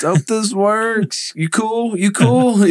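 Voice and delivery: very high, drawn-out timid voice; mock nervous squeak. Salesman checking on a pit bull, nervously squeaking. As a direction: squeak it comically, placating a scary dog.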